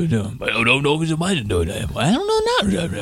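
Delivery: a hint of a befuddled postal worker dithering; mumbling unsure clerk. Imitating clerks muttering in back.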